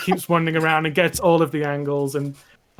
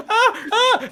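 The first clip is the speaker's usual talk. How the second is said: very high, loud, laughing cries; pained yelps; 5 dB louder than usual. Acted. Apprentice shielding eyes from sun, high yelping. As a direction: cry out against the glare. NPC blinded by sunlight.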